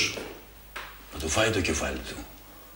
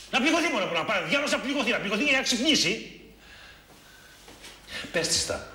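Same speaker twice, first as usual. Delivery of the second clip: heated urging; 14 semitones higher than usual. Acted. Friend mocking, urging confession.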